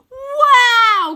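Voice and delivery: put-on high, loud boyish shout of wonder; excited cry. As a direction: cry out in amazement. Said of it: "cries Wynne".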